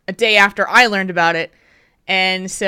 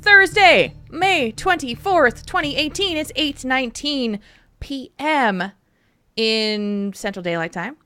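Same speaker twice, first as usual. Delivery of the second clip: high-pitched podcast host intro; show-opening announcement; 6 semitones higher than usual. Acted. Host opening the episode for listeners, show-opening announcement. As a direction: announce the date to open the show.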